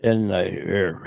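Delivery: ordinary talk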